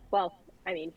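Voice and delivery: ordinary talk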